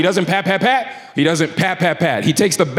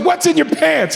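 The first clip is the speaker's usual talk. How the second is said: mock demand; 10 semitones higher than usual. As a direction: demand it like a fed-up agent. Agent demands again.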